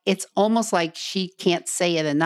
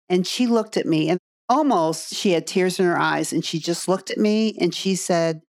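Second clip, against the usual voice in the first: ordinary delivery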